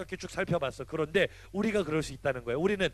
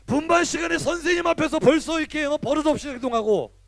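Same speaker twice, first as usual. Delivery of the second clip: higher and louder, pressing the point; fired-up preaching; 8 semitones higher, 7 dB louder than usual. Acted. Pastor scolds teens for misbehaving in class, fired-up. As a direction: preach it hard at the kids.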